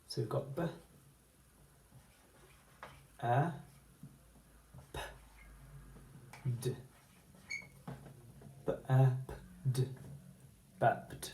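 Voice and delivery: burped said sound by sound, softly. Shown as a demonstration. Teacher sounding out burped for the class. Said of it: "write the word".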